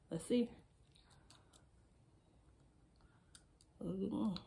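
fitting a mouth tray; muffled by hands at lips; talking with fingers in mouth. Real, not acted. Seen 1: Fingers at mouth pushing aligner in only from 3.1s.

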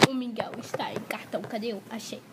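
fast whisper; hushed aside to the camera. Real, not acted. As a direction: whisper it quickly, like a secret. Playing with filter, whispering.

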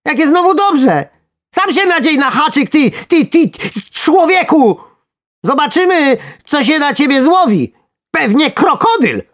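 very high, loud squeaky tirade; furious snarl. A narrator's reading. Worm telling angler to hook himself, furious shrieking. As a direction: rant as an enraged tiny worm. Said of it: "warknął wściekle robak".

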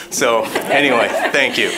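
laughing it off, a little nervous. Real, not acted. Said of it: "I'm actually a little nervous tonight too".

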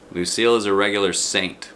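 plain reading voice

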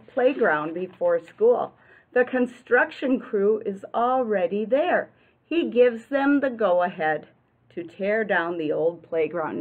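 plain reading voice

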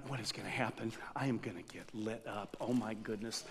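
scared muttering. Retold or imitated. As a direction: quietly panic to yourself. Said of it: "And, and I'm".

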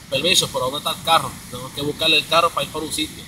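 fast, native-style Spanish accent; normal Spanish. Shown as a demonstration. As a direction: rattle it off in fluent Spanish. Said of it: "speaking normal Spanish".

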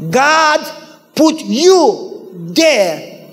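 loud, very slow proclamation; emphatic preaching. Real, not acted. Preacher turning the story on congregation, emphatic. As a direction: proclaim it word by word, forcefully.

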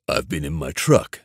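cool, unbothered reply; said coolly. A narrator's reading. Trent answering the gang boss on speaker, cool. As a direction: Trent answers calmly, giving nothing away. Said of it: "Trent said coolly".

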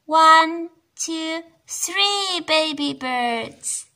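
slow counting with a loud start; counting slowly. Shown as a demonstration. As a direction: count them out for kids. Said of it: "How many baby birds do you see".

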